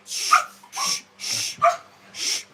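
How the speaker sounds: whispered shushing; hushing. Real, not acted. Hushing the pets.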